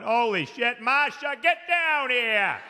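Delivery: high, slow shouted call to Masha; alarmed dad calling. Retold or imitated. Dad calling his wife downstairs, high and drawn out.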